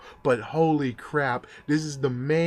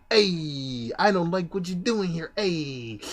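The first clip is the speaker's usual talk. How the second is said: mock overdone tough guy, higher; 6 semitones higher than usual. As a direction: ham up a cartoon wiseguy a bit.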